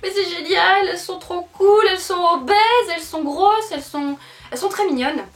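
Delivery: high, loud, thrilled squeals over the dolls; gushing excitement. Retold or imitated. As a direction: gush like a fan who just found treasure. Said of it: "J'étais là genre".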